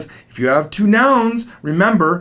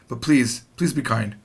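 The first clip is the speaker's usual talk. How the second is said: usual speaking voice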